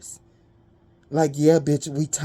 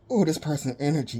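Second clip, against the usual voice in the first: usual speaking voice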